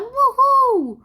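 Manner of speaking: high, loud excited whoop; cheer. Acted. Student celebrates the American win with a whoop. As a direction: cheer like the team just won.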